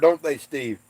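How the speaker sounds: ordinary talk, nothing marked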